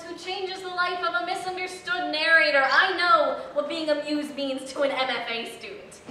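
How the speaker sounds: ordinary delivery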